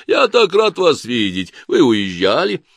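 nasal, delighted exclamation. A narrator's reading. Chief guard greets the judge, nasal exclamation. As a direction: exclaim it through the nose in mock delight. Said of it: "воскликнул он своим гнусавым голосом".

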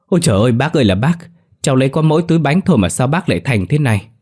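fast, high-pitched lament; tearful wailing. A narrator's reading. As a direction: wail in hammed-up grief. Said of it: "Ông Tú khóc lóc".

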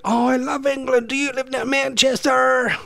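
put-on very high, gushing American girl; American accent. American girl excited about England, hammed-up American accent. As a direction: gush in an exaggerated American accent. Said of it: "just put on American accents".

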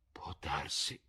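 whispery, voiceless sigh; sighed concession. A narrator's reading. Lord concedes to Dorian, sighing softly. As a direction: let it out on a sigh. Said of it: "sospirò Lord Henry".

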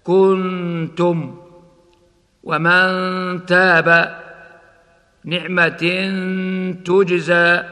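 slow كنتم, humming the hidden ن before ت; ikhfa before ت. Shown as a demonstration. Teacher demonstrating ikhfa before ت, held recitation. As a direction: recite نعمة تجزى with the tanween drawn out. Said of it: "هكذا".

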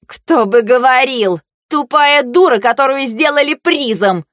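high, heated angry retort; angry roar. A narrator's reading. Marsha answering her rival's taunt, angry roar. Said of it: "Марша гневно заревела в ответ".

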